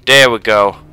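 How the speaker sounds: loud, triumphant, falling; triumphant shout. Real, not acted. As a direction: belt it out in victory. Triumphant repeat after quiet take.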